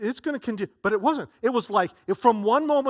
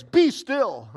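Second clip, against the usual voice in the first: louder, higher stern command; harsh rebuke; 6 semitones higher, 6 dB louder than usual. Acted. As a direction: order the waves to stop. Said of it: "he rebuked the wind by saying".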